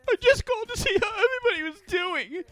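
very high, loud mimicking whine; mocking ex. Ex defending the phone call, mocking high imitation. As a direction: squeal her excuse in a put-on high voice.

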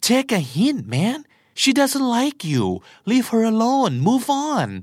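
high-pitched, emphatic friendly scolding; exasperated telling-off. Acted. Friend telling a suitor she isn't interested, exasperated. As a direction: urge him to give up, pitched well up.

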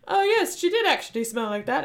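put-on British accent, starting high; mock British. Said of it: "I don't know why they're British".

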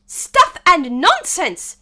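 high, loud, slow scorn; indignant loud outburst. A narrator's reading. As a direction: protest it forcefully, stretching the words. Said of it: "said Alice loudly".

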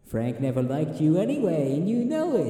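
high sneering tempter voice; sinister conscience. Acted. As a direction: goad him, loud and sly. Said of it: "he gets, like, real sinister".

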